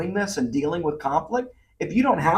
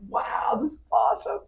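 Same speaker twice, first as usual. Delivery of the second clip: put-on high, hushed, whispery child voice; awed little girl; 6 dB quieter than usual. Dad voicing his youngest daughter seeing Disney pictures, awed. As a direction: imitate a small child softly marvelling in a high whisper. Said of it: "she was like".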